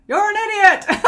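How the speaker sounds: high, loud laughing shout of the insult; the echo hollers back at the Grinch, laughing shout; laughing mock yell. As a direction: bellow it back like the echo, cracking up.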